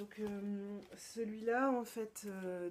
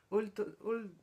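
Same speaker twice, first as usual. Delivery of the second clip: slow, whispered try at an English accent; mock-proud English accent, read slowly under her breath; English accent attempt; 3 dB louder, much slower than usual. Real, not acted. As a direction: sound out the English title in a careful, hushed English accent. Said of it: "mon accent anglais déchire".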